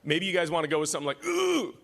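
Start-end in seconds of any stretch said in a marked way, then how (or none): 1.3-1.7 a high strained ooh under nine Gs. Retold or imitated. Host imitates his noise in the F-16, a high strained ooh. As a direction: squeeze out a high ooh like pulling Gs. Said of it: "that was the noise".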